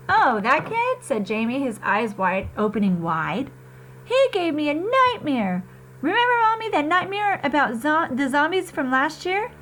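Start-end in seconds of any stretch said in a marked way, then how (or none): 0.1-1.0 put-on higher, bright kid's voice; a small boy remembering, eyes wide; little boy voice. Little brother telling mom, high kid voice. 4.0-9.5 put-on higher excited kid's voice; a small boy telling mommy about nightmares; little boy voice. Little brother telling mom, high kid voice.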